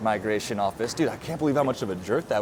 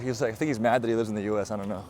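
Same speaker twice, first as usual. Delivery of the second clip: ordinary talk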